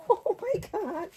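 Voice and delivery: breathless laugh, then a very high, slow "oh my God"; giddy rising squeal of delight; excited squeal. Real, not acted. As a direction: squeal it out as the bag appears. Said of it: "I'm getting excited".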